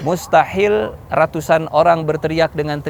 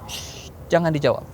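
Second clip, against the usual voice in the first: hushing; 6 dB quieter than usual. Acted. Prophet to companions, hushed order not to answer. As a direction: hush them so nobody answers.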